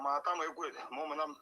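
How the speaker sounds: ordinary delivery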